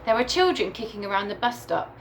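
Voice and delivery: usual speaking voice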